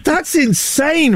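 high, slow mock-astonished; mock amazement. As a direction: stretch out the amazement, tongue in cheek. Radio presenter mock amazement.

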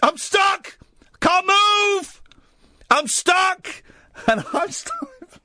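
high, stretched-out cry for help; hammed-up strained, squealing panic; panicking. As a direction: imitate a trapped friend wailing in panic. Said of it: "And he was panicking".

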